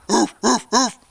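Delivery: three short imitated ruffs; dog barking. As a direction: show a pup how to bark. Mama demonstrates barking to George.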